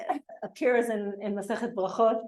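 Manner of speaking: ordinary delivery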